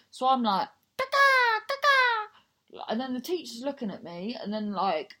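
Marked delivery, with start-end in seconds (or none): 1.0-2.8 very high, loud bird squawks; bird noises. Retold or imitated. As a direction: screech the warning call like a crazy bird. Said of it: "making bird noises".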